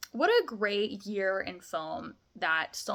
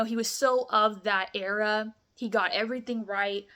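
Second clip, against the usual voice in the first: usual speaking voice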